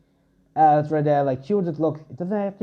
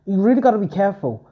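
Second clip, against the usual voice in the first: urgent warning; 6 semitones higher, 3 dB louder, faster than usual. Acted. Hestia warns Bell.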